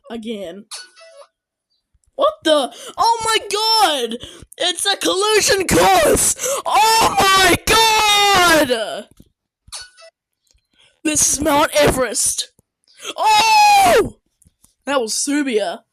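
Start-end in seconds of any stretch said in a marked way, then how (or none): 2.2-9.1 high, loud drawn-out panic; panicked shriek. Real, not acted. Watching the collision course, panicked shriek. 13.2-14.2 very high loud yelp; startled shriek. Real, not acted. Seeing Mount Everest scene, startled shriek.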